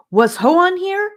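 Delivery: high, loud and eager; urgent question. Acted. Charlie just woken, urgently asking about Hoan, high and loud. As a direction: blurt it out, wide awake now.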